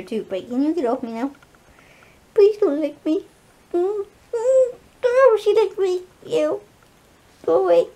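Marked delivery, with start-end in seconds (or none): 2.3-7.9 high, whiny protest, a bit tearful; squealing at dog. Real, not acted. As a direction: squeal it away from the licking dog. Fending off licking dog.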